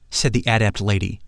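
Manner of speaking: ordinary delivery, nothing marked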